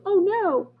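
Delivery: high mock worried oh no; lightly voiced worried friends; worried animals. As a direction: squeak it high and anxious. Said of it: "they're like".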